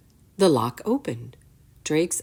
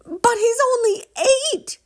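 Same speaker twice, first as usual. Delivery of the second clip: high, loud desperate cry; mother yelling; 6 dB louder than usual. A narrator's reading. Mother crying out as soldiers take her boy. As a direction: cry out to stop them taking her son. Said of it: "his mother yelled".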